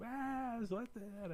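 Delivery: put-on high, whiny little kid. Retelling his son in the stalled car, a high whiny kid.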